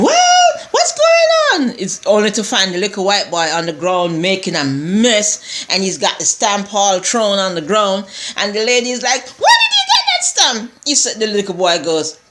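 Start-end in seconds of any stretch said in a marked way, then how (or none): 0.0-1.8 very high, drawn-out mock shriek; quarreling lady. Lady finds the boy's mess, high quarreling mimicry. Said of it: "you hear the lady quarreling and carrying on". 9.4-10.7 very high-pitched mock shriek; imitated shrill demand. Lady confronts the little boy, high mock shriek.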